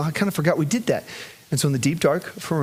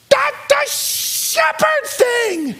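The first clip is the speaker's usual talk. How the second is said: put-on child's demand; much slower than usual. Daughter demanding father's prayer, high slow shout. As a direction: insist like a determined toddler.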